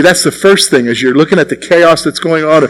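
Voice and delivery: ordinary talk, nothing marked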